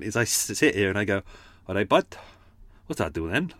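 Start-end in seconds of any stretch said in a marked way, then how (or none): 1.7-3.5 warm-up phrase in a South Wales lilt; easy Cardiff accent with a questioning rise. Shown as a demonstration. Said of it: "That's my way to get into Tim's accent".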